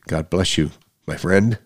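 normal talking voice

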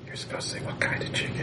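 whispering. Acted. Child whispers a prompt to dad.

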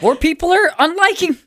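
high-pitched dismay; distressed. Acted. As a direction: report it in a high, panicky voice. Distressed as more unlikes arrive.

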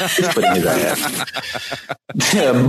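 words stretched out, slowed by laughter; laughing through words. Real, not acted. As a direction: crack up mid-sentence and drag it out. Laughing at her own slip.

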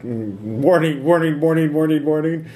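high mechanical warning voice; robot alarm voice. Acted. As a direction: sound the alarm like a machine. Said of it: "but they're like".

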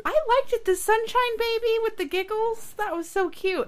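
high, gushing delight. Real, not acted. Gushing to friends about the giggling Teletubbies baby.

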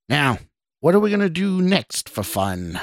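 wicked taunt, mildly done; snarling stranger. A narrator's reading. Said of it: "the stranger snarled".

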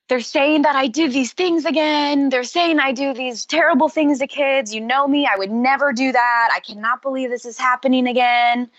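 a touch of tearful pleading; upset Michael. Retold or imitated. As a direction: retell his tearful plea with a hint of his voice. Retelling Michael crying at car.

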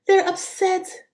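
a hint of a condescending politician; mocking politicians. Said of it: "they love to say".

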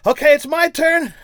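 very high, slow, loud squeal; comic falsetto of agony; pained squeal. Acted. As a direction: squeeze it out after a kick to the groin. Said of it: "Buddy Hackett on the ground acting out this shot".

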